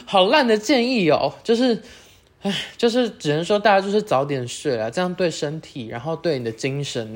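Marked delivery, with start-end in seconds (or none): 0.0-1.3 real laugh, then a higher laughing remark; self-mocking giggle; laughing at herself. Real, not acted. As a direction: laugh at your own lame advice.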